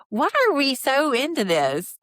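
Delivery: high, loud exasperated question; incredulous rant. Real, not acted. Exasperated rant about show.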